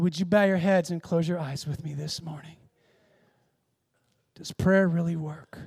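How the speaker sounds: softened, hushed sermon close; altar-call invitation. Real, not acted. Preacher inviting bowed congregation to pray, quietly earnest. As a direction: ease them gently into prayer. Leads closing invitation to prayer.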